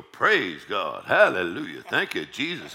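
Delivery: loud praise shouts, punched then falling; exuberant praise. Shown as a demonstration. Preacher showing how to start the morning, exuberant praise. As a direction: belt out short praises in a rhythmic string. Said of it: "Start off in the morning, go".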